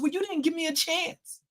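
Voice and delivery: high, soft, whiny mock of herself; emotional retort. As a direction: play it like a wounded child. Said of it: "I was being emotional".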